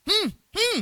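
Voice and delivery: two high, loud hums; wordless signal. Retold or imitated. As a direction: answer only in insistent hums. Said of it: "dia cuma pakai bahasa isyarat". (dua dengungan isyarat tanpa kata)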